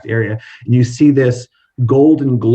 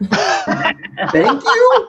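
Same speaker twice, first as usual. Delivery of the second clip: laughing. Acted. Thanked with a laugh.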